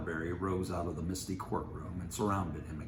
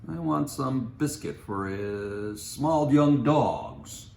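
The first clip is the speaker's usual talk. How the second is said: slow, loud, higher, over-careful; slow careful request; 7 semitones higher, 10 dB louder, much slower than usual. Acted. Mitty asking the clerk for dog biscuit, slow and deliberate. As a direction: spell it out to the clerk.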